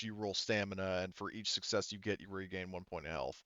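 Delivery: normal talking voice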